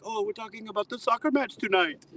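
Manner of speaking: put-on high, goofy German-accented English. German officer explains the soccer talk, goofy German accent. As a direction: play the harmless goober. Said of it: "as soon as they speak English ... goober".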